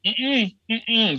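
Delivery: high, sassy no-way hum; sassy refusal. Retold or imitated. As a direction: shut it down with attitude. Refusing the late flight.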